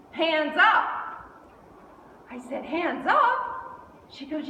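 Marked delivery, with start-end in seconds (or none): 0.1-1.3 high, loud, rising challenge; emphatic command. Retold or imitated. Daughter adding a rule for Mom, emphatic command. Daughter adds a new demand. 2.6-4.1 loud, high rising question; incredulous echo. Retold or imitated. Mother questioning daughter's new rule, incredulous echo.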